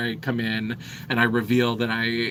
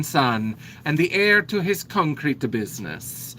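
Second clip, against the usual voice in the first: put-on a light Italian accent, slowing on the son's name; 3 semitones higher than usual. Contessa presents her son as heir, light Italian accent. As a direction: let the contessa savour each word in a mild Italian accent. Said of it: "why they asked me to do accents".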